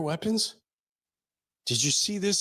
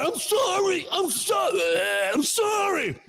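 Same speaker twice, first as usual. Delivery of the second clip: high, shouted squealing pleas; hammed-up pleading voice, very high; mock begging; 12 semitones higher than usual. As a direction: squeal for mercy while being choked. Choked guy begging in story.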